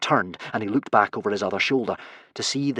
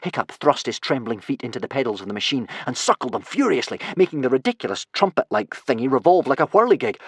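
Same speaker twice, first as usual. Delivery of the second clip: usual speaking voice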